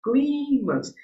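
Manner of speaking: "Clímax" with a high, long CLÍ; high "CLÍÍ-max", answering own question; stressed-syllable demo. Shown as a demonstration. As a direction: lift and hold the first syllable of "Clímax". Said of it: "a sílaba tônica aqui, qual foi?".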